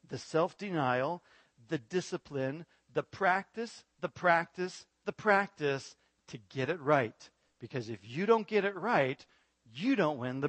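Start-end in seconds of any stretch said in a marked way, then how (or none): 2.9-7.3 practice stressed each time, rising then falling; building rhetorical repetition of practice; repeated emphasis. Real, not acted. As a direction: drum the word practice home. Preacher hammers repeated word.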